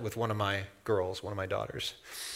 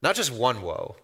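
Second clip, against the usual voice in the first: ordinary delivery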